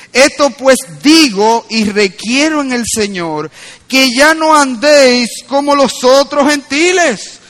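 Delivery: emphatic, drawn-out preaching; forceful preaching. Real, not acted. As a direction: preach it slowly with rising force.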